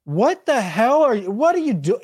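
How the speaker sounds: high, incredulous outburst; disbelieving what are you doing; exasperated. Real, not acted. Exasperated at play call.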